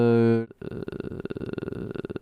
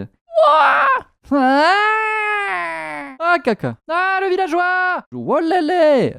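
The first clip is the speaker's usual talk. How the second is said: loud high scream; 14 semitones higher, 10 dB louder, slower than usual. Real, not acted. Streamer screaming at the game, loud and high. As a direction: yell out in shock. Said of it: "désolé pour les bruits".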